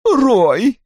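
high-pitched plaintive call; whimpering plea. A narrator's reading. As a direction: whine the name to restrain him. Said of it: "опять заскулила Синтия". (жалобно, скуля)

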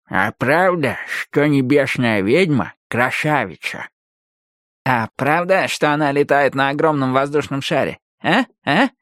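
a hint of a slow, curious character voice; lightly voiced eager listener. Skinjacker begs for a tale about the sky witch, eagerly curious.

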